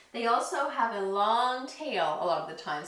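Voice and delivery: ordinary talk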